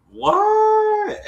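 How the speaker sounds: a long high squealed what; shocked what. Retold or imitated. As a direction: shriek it out in disbelief. Neve and Cammy react in shock.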